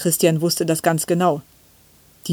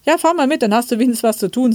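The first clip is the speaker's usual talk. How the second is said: high-pitched, quick mother's reply; lively raised pitch, hurrying along; brisk motherly consent; 6 semitones higher, 3 dB louder, faster than usual. A narrator's reading. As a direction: send the child off briskly. Mother answers child's eager request.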